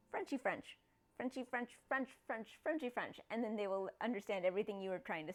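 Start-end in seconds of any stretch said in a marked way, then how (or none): 0.1-3.2 soft, nasal-ish fake French; mock French babble. Shown as a demonstration. Demonstrating fake French to chat. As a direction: mumble Frenchy French like gibberish. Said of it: "and you just say".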